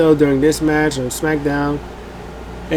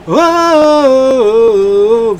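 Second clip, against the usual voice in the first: excited shout; 9 semitones higher, 7 dB louder than usual. Pretend. Crowd pops as bell rings.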